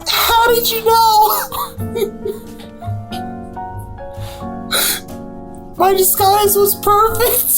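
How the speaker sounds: high squeaky cartoon mouse; Mickey Mouse voice. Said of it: "Are you Mickey Mouse?".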